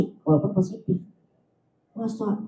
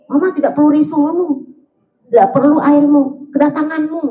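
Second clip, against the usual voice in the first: higher, louder hurt mother; mother to absent child, higher and louder in hurt pride; offended mother; 6 semitones higher, 4 dB louder than usual. Retold or imitated. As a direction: refuse the gifts with wounded pride.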